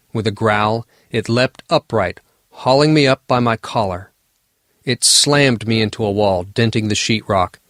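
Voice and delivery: usual speaking voice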